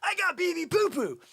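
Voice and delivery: pumped-up, high-pitched shout. Acted. Salesman voices the thrilled buyer of a coin, pumped. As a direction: crow it like you've just scored big. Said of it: "You're gonna be like".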